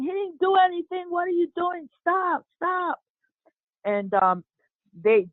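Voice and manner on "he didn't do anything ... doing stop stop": restrained mock scream, pitched way up; retold screaming. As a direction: imitate onlookers screaming in protest. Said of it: "we all were screaming".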